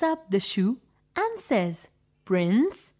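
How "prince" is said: plain reading voice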